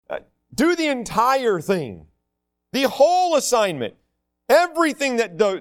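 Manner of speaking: preacher stressing obedience; emphatic. Acted. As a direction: drive home the whole thing. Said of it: "emphasising entire obedience".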